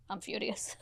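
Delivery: a hushed seething aside; quiet furious aside. Retold or imitated. Telling James he's furious, in a hushed aside. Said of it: "I just went to James".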